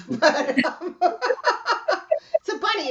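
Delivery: high, loud, laughing through it; helpless giggle into a high voice. Real, not acted. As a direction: crack up at your own joke. Laughing at own rat joke.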